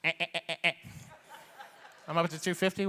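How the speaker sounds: ordinary talk, nothing marked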